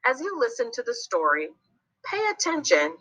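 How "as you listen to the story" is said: ordinary delivery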